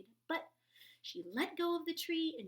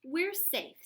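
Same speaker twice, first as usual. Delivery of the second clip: relieved; 8 semitones higher, 10 dB louder than usual. A narrator's reading. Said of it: "said Stellaluna".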